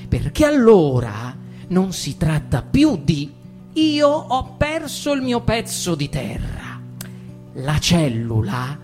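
ordinary talk

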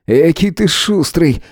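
slow, high-pitched cross retort; angered. A narrator's reading. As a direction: draw out an irritated rebuke. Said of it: "разозлился Шуляк". (сердито, с растяжкой)

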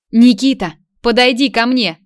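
loud call across a room. A narrator's reading. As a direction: call the boy over, loud. Mother calls child across room.

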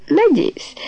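high-pitched, mildly haughty reply; self-important. A narrator's reading. Tony admires herself in the mirror, self-important. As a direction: say it with an important air. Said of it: "с важным видом сказала Тони".

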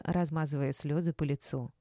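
ordinary delivery

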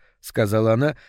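plain reading voice

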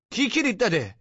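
very high-pitched, indignant question; sharp demand. Acted. Tenant asking intruder about the key, indignant demand. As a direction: demand it with voice shooting up.

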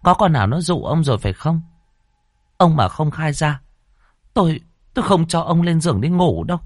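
high, sharp scolding of a suspected husband; wife's angry questioning. Acted. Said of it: "Bà liền chồm dậy hỏi to".

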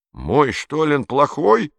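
high, slow, indignant cry; indignant outcry. A narrator's reading. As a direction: howl it out, offended. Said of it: "возмущённый вопль Эммы".

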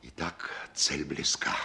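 a secret whisper to himself. Acted. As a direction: breathe it out, scheming. Secret aside, plotting alone.

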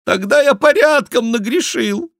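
high, loud wail; theatrical high-pitched lament; despairing cry. A narrator's reading. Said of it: "с отчаянием вскричал Шико".

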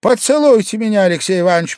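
put-on high, loud drunken request; Pavel Pavlovich, sudden plea. A narrator's reading. Guest suddenly proposing a kiss, high and pleading. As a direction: blurt the request at high pitch. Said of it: "предложил он вдруг".

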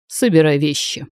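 a touch of angry uncle; mildly angry, muffled command. A narrator's reading. Uncle orders his niece to pack, understated anger. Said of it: "сердитый голос".

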